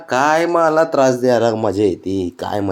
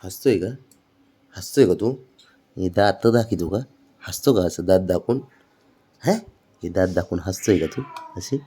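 softer teasing coo; 5 semitones lower, 6 dB quieter than usual. Real, not acted. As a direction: tease him gently. Teasing grinning pet softly.

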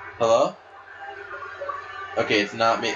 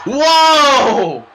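very loud, high drawn-out whoa; amazed yell; 10 semitones higher, 10 dB louder than usual. Real, not acted. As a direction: let out a long stunned whoa. Reacting to a huge dive.

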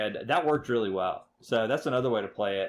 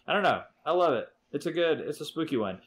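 loud, high burst of affection; enthusiastic; 7 semitones higher, 4 dB louder than usual. Real, not acted. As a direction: blurt out how much you love it. Declaring love for the game.

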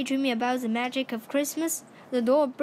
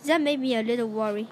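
plain reading voice

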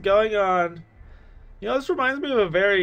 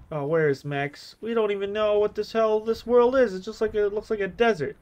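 normal talking voice

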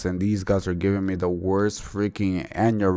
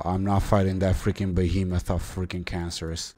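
ordinary talk

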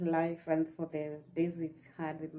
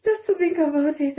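much higher and louder, squealing with delight; thrilled; 10 semitones higher, 9 dB louder than usual. Real, not acted. Telling viewers her son turns three, squealing with excitement. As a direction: gush like you can't believe it. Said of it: "I'm super excited".

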